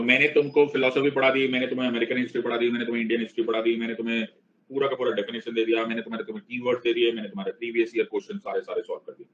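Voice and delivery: rapid list. Acted.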